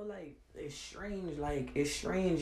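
drops to a soft, slow murmur; soft slow disbelief; quiet and slow. Real, not acted. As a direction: let it sink, quietly and slowly. Ranting about strange people.

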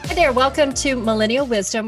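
ordinary talk, nothing marked

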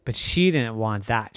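ordinary delivery, nothing marked

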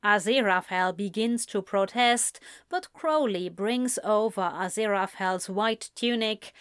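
ordinary delivery, nothing marked

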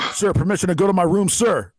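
high-pitched military yes-sir; mock recruit. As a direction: answer dad like a recruit to a drill sergeant. Mock recruit answering the dad.

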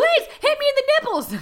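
very high, quick squealed plea; hammed-up begging on the edge of laughter; mock desperate plea. Host tops the parody bit with mock desperate pleading.

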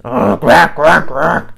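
loud beast yell; yelled quack. A narrator's reading. Beast yelling to wake the bird. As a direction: yell the quacks like an angry monster. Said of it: "yelled Grackle".

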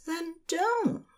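high, soft coy reply; a hint of teasing, hushed; playful angel voice. Angel flirts back.

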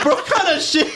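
high-pitched mock outrage; hammed-up exasperation, laugh underneath; retold indignation. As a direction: protest in a high, incredulous voice. Acts out outrage at being skipped.

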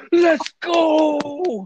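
high, excited "Let's go!" shout; a high hype-man shout; hype cheer. Pretend. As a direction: hype up the crowd. Hype entrance after confetti drops.

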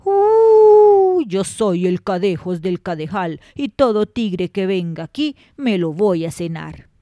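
put-on loom over the old woman as a terrifying beast; horrible monster voice. A narrator's reading. The Cadejos threatens the old woman in her bed, booming monster voice. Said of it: "oye una voz que le dice".

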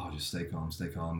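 soft, hushed self-calming; calming myself. Retold or imitated. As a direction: talk yourself down quietly so you won't wake up. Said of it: "and I was like".

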